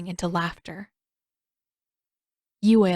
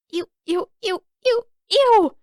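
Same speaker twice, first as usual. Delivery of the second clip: squealing ew, much higher and louder; disgusted; 13 semitones higher, 5 dB louder than usual. Acted. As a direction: recoil in loud disgust. Katara disgusted by Han's suggestion.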